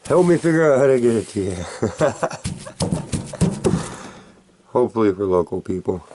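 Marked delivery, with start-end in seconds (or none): 0.0-4.7 laughing as he talks; quick laughing request. Real, not acted. Laughing while asking.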